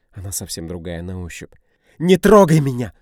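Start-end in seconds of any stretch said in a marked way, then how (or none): 2.0-2.9 much higher, louder scream; woman screaming to be let go. A narrator's reading. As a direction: shriek it at him. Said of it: "начинает Дина кричать".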